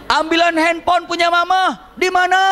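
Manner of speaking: family shouting back and forth indoors; yelled exchange. Retold or imitated. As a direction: shout the request across the house.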